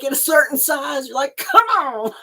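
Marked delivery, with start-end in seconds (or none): 1.4-2.1 exasperated come on; frustrated. Retold or imitated. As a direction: huff it out in frustration. Frustrated at sizing yarn.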